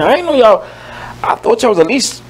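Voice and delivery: ordinary talk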